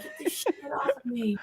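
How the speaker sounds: high laughing dog voice; dog's inner voice. Retold or imitated.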